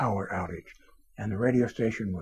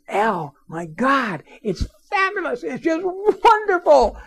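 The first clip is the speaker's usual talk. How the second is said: much higher, gushing boss voice; thrilled boss; 14 semitones higher, 5 dB louder, slower than usual. Retold or imitated. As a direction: gush like a delighted station owner. Station owner thrilled by response.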